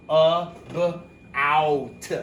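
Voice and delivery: A, b, out said apart, each vowel full; split into sounds. Shown as a demonstration. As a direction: sound out A, b, out slowly. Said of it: "these make different vowel sounds".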